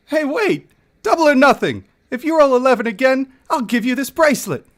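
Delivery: high, pushy dwarf bargaining; desperate gambler begging for double or nothing; eager dwarf. Acted.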